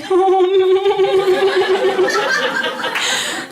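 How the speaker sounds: high, nervous giggle. Real, not acted. Leaning in close, she giggles nervously. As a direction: giggle with nerves. Shaking as he leans in.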